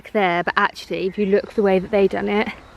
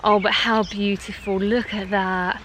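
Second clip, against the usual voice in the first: normal talking voice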